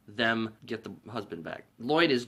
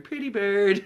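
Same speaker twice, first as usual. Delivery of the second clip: put-on higher, innocent little kid; 8 semitones higher, slower than usual. Blind kid petting the dead bird, sweet child voice. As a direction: coo it like a child.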